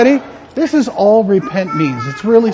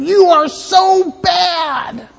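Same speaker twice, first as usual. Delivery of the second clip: high, loud, slow mock scolding in southern style; hammed-up condemnation; scolding preacher; 8 semitones higher, 4 dB louder, much slower than usual. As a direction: drag out the scolding like a finger-wagging preacher. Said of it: "as if they were saying".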